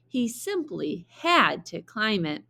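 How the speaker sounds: usual speaking voice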